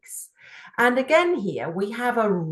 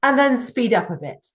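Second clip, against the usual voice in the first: quicker, brisker delivery; speeding up; 4 semitones higher, 4 dB louder, faster than usual. Shown as a demonstration. Teacher speeding up to show the listener good pacing. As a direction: rush the words a little faster. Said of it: "like now".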